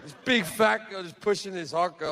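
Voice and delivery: ordinary delivery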